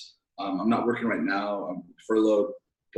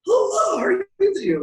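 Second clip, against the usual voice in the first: hammed-up high, loud hello; exaggerated squeaky welcome; greeting with cracking voice; 9 dB louder than usual. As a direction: greet visitors at full pitch, voice straining. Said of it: "my voice is cracking".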